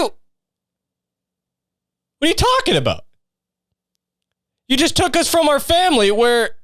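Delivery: put-on very high, incredulous kid voice; the taken kids balking at a priest; mock outraged kid. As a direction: squeak it out in disbelief.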